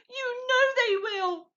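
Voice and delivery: high, tearful sobbing plea. A narrator's reading. Mrs Fox pleading with Mr Fox, sobbing high. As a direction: sob it to your husband. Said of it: "sobbed Missus Fox".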